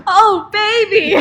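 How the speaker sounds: loud, high, drawn-out mock moan; hammed-up ecstasy. Co-host acts an obvious orgasm, mock ecstatic cry. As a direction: slowly belt out fake passion, half laughing. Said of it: "but, like, an obvious".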